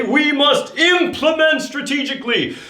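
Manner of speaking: high, bold warrior declaration. Shown as a demonstration. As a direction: proclaim it like a warrior. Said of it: "say it like a warrior".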